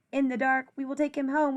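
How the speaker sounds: plain reading voice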